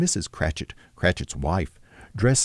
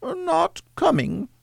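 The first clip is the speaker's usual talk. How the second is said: very high, slow falsetto mother; Cratchit's wife; slower than usual. A narrator's reading. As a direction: draw it out in a high housewife voice. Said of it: "said Missus Cratchit".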